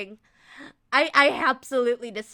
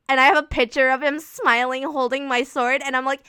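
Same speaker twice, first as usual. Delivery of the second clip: ordinary talk, nothing marked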